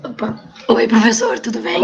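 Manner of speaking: raspy, worn-out voice; hoarse voice. Real, not acted. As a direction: speak through a hoarse throat. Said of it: "Eu tô com a voz rouca".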